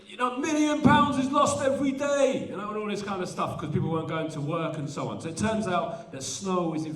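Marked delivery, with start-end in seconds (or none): 0.1-2.5 higher, louder panicky news voice; mocking the media. Poet mimicking the media panicking over snow, mock alarm. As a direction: parody the alarmed press. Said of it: "The media were going".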